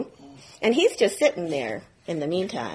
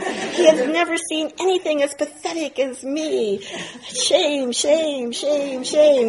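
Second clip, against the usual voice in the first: high, laughing self-pity, building to the last shame; spiralling shame; 7 semitones higher than usual. Retold or imitated. Student mocking his own spiral of shame after the teacher's remark, high and wailing. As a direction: wail in mock despair.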